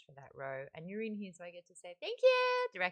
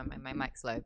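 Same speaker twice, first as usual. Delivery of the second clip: normal talking voice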